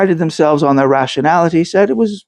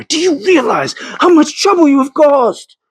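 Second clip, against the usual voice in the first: much higher, indignant rebuke; angry scolding; 9 semitones higher than usual. A narrator's reading. Thomas berates Catherine over the stolen relic, furious. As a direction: berate her for the trouble she caused.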